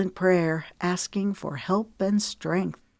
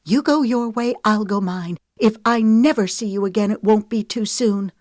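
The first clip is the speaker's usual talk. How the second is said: louder, higher, curt farewell; snippy dismissal; 5 semitones higher, 5 dB louder than usual. A narrator's reading. Lemon cutting off Wesley for good, snippy and loud. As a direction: dismiss him sharply. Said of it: "Lemon snipped".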